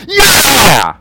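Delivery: loud, high football fan's yell; shouted cheer. Acted. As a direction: yell like your team just scored. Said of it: "you're going".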